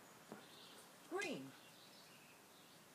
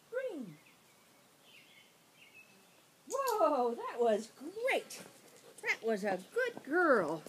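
talking to a dog; 4 semitones higher, 6 dB louder, slower than usual. Real, not acted. Excited praise for dog.

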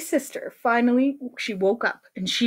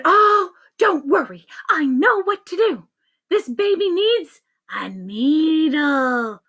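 higher, singsong, slowing to a drawn-out finish; confident doctor; 5 semitones higher, slower than usual. Acted. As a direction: announce the cure with relish. Doctor announces her cure.

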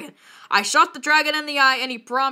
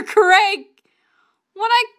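usual speaking voice

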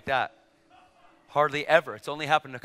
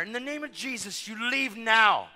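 raised pitch, slowing to a drawn-out order; commanding; 7 semitones higher than usual. Retold or imitated. Preacher casting out the devil, commanding. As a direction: order it out with authority.

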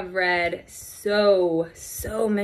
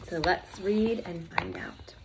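whisper; 8 dB quieter than usual. Real, not acted. Teacher whispering to children before the story starts. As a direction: lean in and whisper the invitation. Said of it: "teacher whispers before reading".

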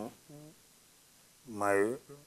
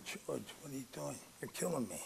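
quiet, pained aside to George; hushed plea; 4 semitones higher, 11 dB quieter, faster than usual. Retold or imitated. Begging his drunk teammate George to stop, under his breath.

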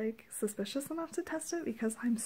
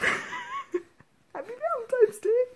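slow, laughing high greeting; laughing with joy; 13 semitones higher, 8 dB louder, much slower than usual. Real, not acted. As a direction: laugh it out, giddy.

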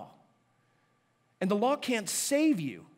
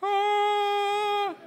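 high app chime; 14 semitones higher, 6 dB louder than usual. Pretend. Sound effect after downloaded app.

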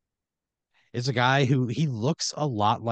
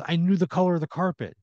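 higher-pitched insistence; insistent pleading; 7 semitones higher, 3 dB louder than usual. Acted. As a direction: plead your case with a raised pitch. Character argues his claim to house.

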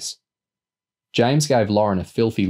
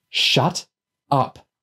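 fierce, higher-pitched snap in two separate words; 5 semitones higher than usual. A narrator's reading. Girl losing patience with noisy kids, fierce. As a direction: bite out each word on its own. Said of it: "she said fiercely".

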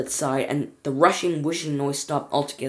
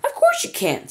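high squeak. Acted. Wonka brushing it off.

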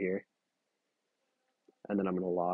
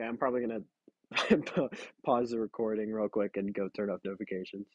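ordinary delivery

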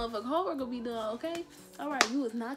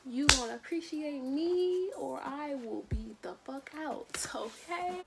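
whispered mock threat; 3 semitones higher than usual. Vlogger whispers a joking threat at a rival. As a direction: hiss it under your breath.

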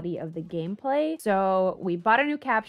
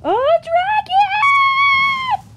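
shocked shriek at dragon; 12 dB louder, much slower than usual. Real, not acted. Gamer spots a dragon and shrieks in long, loud delight. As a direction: gasp then a long, much higher shout.

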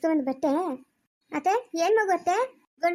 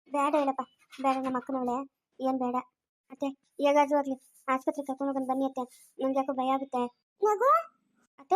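usual speaking voice

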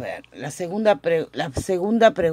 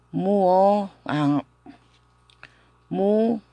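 drawn-out vowels with pauses between attempts; sounding out; 2 semitones higher, much slower than usual. Shown as a demonstration. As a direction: stretch each piece slowly for learners. Teacher sounds out new word. (alargando las sílabas, con pausas)

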